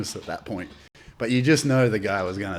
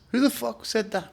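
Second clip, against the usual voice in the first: high, sharp confrontational demand; menacing challenge; 12 semitones higher than usual. Retold or imitated. Comedian to heckler, high menacing challenge. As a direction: call out the heckler, daring him to own it. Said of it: "he goes".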